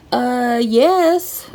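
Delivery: high, put-on voice, sly yes; a cheeky inner yes; smug. Inner answer to siblings' jab, high smug yes.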